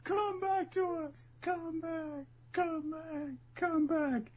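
high, wailing cries of come back; hammed-up tearful wail over a fallen fighter; high, falsetto mock crying; mock sobbing plea. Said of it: "starts crying and she says".

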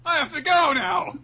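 high, loud mock yell; hammed-up hurried dismissal; brusque shouting. Luffy brusquely leaving.

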